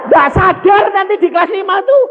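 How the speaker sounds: high, loud shout; a preacher's loud, high exclamation; exclaiming shout. Real, not acted. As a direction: cry it out to the congregation. Preacher exclaims about fifth grade.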